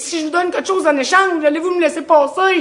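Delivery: put-on high, loud pleading child's voice; pleading kid. Kid pleading with the bully to let him pass, high and loud.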